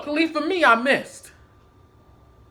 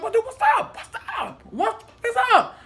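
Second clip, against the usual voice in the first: very high-pitched taunting challenge; confrontational; 11 semitones higher than usual. Retold or imitated. Challenging coworker while being held back, confrontational. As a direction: keep calling him out, voice straining upward.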